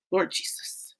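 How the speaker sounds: breathy whispered prayer. Real, not acted. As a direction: whisper it in dismay. Reacting to pregnancy comments.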